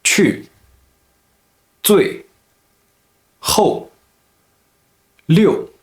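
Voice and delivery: 去, 最, 后, 六 each starting high and dropping sharply; fourth tone. Shown as a demonstration. Teacher showing learners 去, 最, 后, 六 in sharp falling tone. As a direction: drop 去, 最, 后, 六 from high to bottom. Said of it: "pronounced in the fourth tone".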